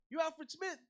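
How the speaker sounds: loud, high shouted question; calling out. Retold or imitated. Fan confronting the singer in a crowd, calling out. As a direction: holler it over a crowd.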